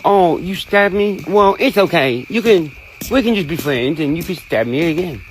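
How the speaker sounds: put-on high voice, exaggeratedly accepting; mock forgiving. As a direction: play a sarcastically over-forgiving pushover.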